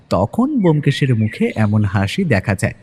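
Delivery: usual speaking voice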